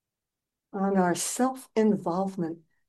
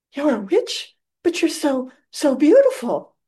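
amazed Dorothy; 6 semitones higher, 6 dB louder than usual. Retold or imitated. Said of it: "she said".

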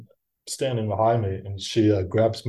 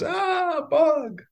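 very high mock squeal; imitating a startled woman, pitched way up; freak out. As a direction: squeal in hammed-up panic. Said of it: "freak out moment".

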